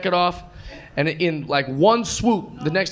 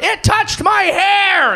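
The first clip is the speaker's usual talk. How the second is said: much higher, slow hammed-up scream; panicked shriek; 13 semitones higher, 5 dB louder, much slower than usual. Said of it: "The passengers going".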